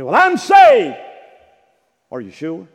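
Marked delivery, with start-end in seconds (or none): 0.0-1.0 loud, high mock claim; mock churchgoer. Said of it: "You say".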